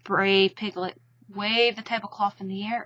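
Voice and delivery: plain reading voice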